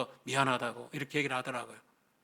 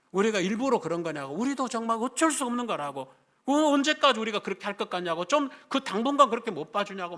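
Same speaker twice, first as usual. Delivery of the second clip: higher-pitched mock yell of protest; imitated yelling; 6 semitones higher, 4 dB louder than usual. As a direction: act out an upset pastor's wife having it out. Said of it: "막 소리를 내지르는 거예요". (따지며 소리 지르는 흉내)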